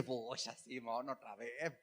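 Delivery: low, hushed letdown; mild dismay. Acted. Bible reader reacting to Simón's repeated fear, mildly dismayed. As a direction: sigh it under your breath, a touch let down.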